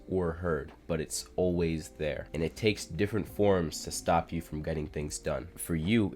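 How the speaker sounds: normal talking voice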